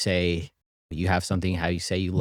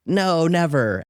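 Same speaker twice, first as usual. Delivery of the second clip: imitated daughter, clearly higher voice; 8 semitones higher, slower than usual. Daughter answering whether daddy will be mad, imitated higher voice. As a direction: raise the pitch to play the daughter. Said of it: "She's like".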